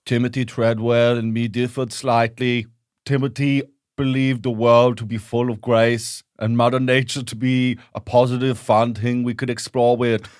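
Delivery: put-on solemn German-accented documentary narration; Werner Herzog. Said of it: "it's only Werner Herzog".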